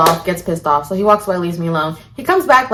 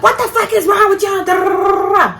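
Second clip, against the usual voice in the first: high mock scolding voice; imitating angry grandma; 10 semitones higher than usual. Granddaughter mimics grandma cussing them out, high and shrill. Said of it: "she's cussing my cousin and me out".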